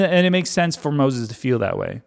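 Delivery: usual speaking voice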